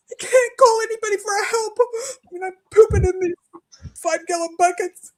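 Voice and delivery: high, tearful words breaking into a sob; crying through the embarrassment. Real, not acted. Admitting to the counselor she can't call for help, crying.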